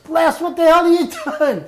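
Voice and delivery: high, laughing disbelief. Retold or imitated. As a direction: laugh it out at your friend. Spotting his friend at the crash.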